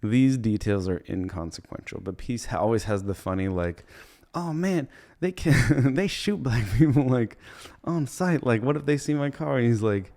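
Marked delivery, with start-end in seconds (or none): 4.3-9.6 high, worried squeal; mock panic. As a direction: fret like the cops are coming. Peace panicking about cops.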